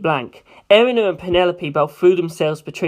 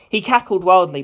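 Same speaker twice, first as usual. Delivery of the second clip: ordinary delivery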